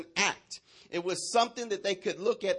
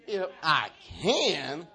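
very slow, swooping sass; with attitude; much slower than usual. Shown as a demonstration. Preacher corrects the neck popping, with attitude. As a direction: drag it out like a neck roll. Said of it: "Roll that neck like with attitude".

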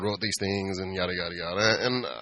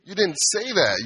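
higher, whiny protest; high protesting customer; 7 semitones higher than usual. Retold or imitated. Customer protesting to the bartender, higher and whiny. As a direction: play the flustered customer, voice up.

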